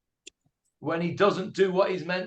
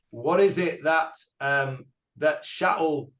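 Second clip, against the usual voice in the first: ordinary talk, nothing marked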